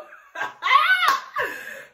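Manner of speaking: high burst of laughter. Real, not acted. Laughing while starting story.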